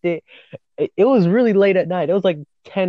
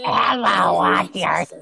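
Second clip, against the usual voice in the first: possessed voice; 3 semitones higher, slower than usual. Retold or imitated. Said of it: "high pitched for it to match the voice".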